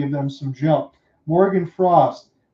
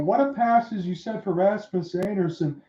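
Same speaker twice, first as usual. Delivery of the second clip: usual speaking voice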